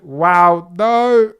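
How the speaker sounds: shocked streamer yelling at the first corner; excited shouting. Real, not acted. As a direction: scream it as the overtake happens. Race start, shocked by overtake.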